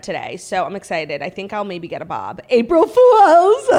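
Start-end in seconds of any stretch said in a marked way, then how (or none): 2.5-3.8 much higher, louder slow prank cry; gleeful shout. Pretend. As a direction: shout the gotcha with laughter. Prank reveal on haircut.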